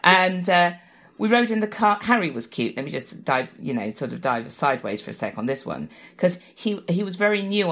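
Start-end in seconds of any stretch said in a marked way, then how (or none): none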